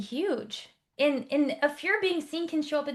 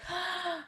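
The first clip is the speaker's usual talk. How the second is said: sharp gasp of realization; 7 semitones higher, 7 dB quieter than usual. Retold or imitated. Client realizes pattern.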